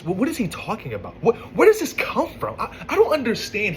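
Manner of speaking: high, bewildered what; exasperated disbelief. Real, not acted. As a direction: throw up your hands in confusion. Baffled by the boyfriend's text.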